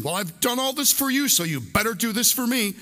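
high, pushy tit-for-tat tone; mock demanding voice. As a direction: sneer it like a guilt trip. Voicing a begrudging demand.

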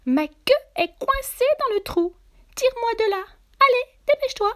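high, loud, urgent rabbit voice; crying out. Acted. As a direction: yelp for help as a trapped rabbit. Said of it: "s'écria le lapin". (lapin affolé, voix suraiguë et pressante)

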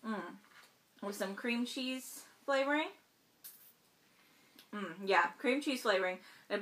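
talking while chewing; speaking between bites; mouth full. Real, not acted. Seen 2: bite at -1, chewing with hand over mouth through 5.6.

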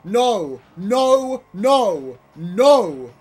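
loud, high cries of disbelief; distraught shouting. Real, not acted. Player watching the run die, distraught shouting. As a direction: yell each burst in real despair.

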